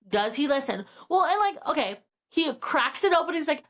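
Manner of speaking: ordinary talk, nothing marked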